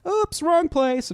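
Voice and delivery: high, slow sing-song oops; mock embarrassed intruder. Imagining a stranger who walked in, mock apologetic oops. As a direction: play someone backing out of the wrong room.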